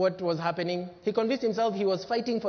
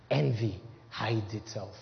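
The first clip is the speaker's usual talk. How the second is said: low hush; 10 semitones lower, 6 dB quieter, slower than usual. Shown as a demonstration. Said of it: "It's too ugly to rear its head".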